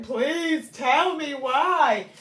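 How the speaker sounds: mock begging the universe. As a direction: stretch it out as a desperate appeal. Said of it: "and I begged".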